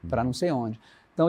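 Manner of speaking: ordinary delivery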